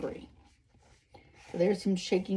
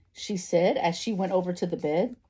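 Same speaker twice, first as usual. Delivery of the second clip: ordinary delivery, nothing marked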